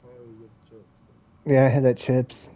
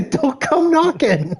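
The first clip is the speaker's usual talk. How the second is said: slow mock warning; 11 semitones higher, slower than usual. Repeating sign, slow, high.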